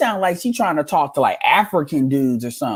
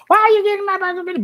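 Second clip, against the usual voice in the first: much higher, fast, put-on voice; mock shrill voice; much faster than usual. Mocking Brandy's take, in a much higher fast put-on voice. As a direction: zip through it like a mocked outsider. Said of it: "I would be like".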